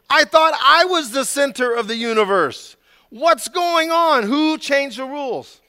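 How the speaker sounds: high, loud mock complaint; indignant child's protest. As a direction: complain like a dethroned toddler. Child protesting at changed rules.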